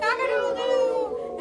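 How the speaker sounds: loud, high hammed-up crow; rooster crow. Reading to child, the flustered fowl crows loudly. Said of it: "who crowed".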